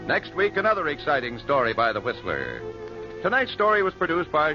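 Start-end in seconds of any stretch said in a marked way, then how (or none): none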